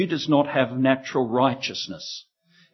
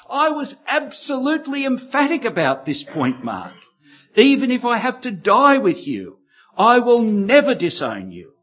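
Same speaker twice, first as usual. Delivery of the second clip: high, pressing, every word insisted; emphatic insistence; 7 semitones higher than usual. Acted. Peter telling Mark he never denied, emphatic and high. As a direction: swear it to him, firmly and urgently. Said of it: "Peter insisted emphatically".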